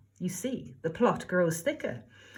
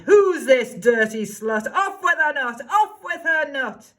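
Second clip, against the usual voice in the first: shouting; 5 semitones higher, 7 dB louder than usual. Acted. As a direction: roar the order. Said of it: "The prince cried".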